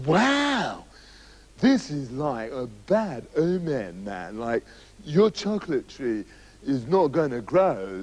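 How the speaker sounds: slow, low dreamy drawl; lightly voiced stoner wizard, slow and low; spaced-out hippie magician. Hippie magician telling the king about a bad omen, slow dreamy drawl.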